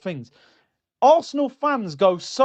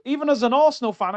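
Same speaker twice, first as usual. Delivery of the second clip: ordinary delivery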